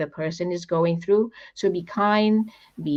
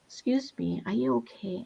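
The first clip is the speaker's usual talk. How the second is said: soft, hushed asking; gentle question; 2 semitones higher, 9 dB quieter than usual. Retold or imitated. Friend asking a frazzled stranger in line, softly. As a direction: ask quietly so as not to startle.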